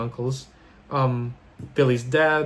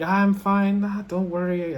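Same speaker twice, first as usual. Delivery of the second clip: dismissive wave-off; 7 semitones higher, slower than usual. Retold or imitated. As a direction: imitate a grandmother waving away concern. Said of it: "waves off dismissively".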